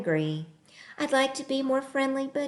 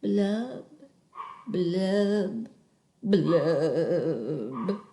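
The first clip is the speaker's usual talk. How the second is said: low, sinking blub blub blub, each lower; gloomy fish blubs; 4 semitones lower, much slower than usual. Acted. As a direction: let the bubbles drop down sadly. Fish's bubbling refrain.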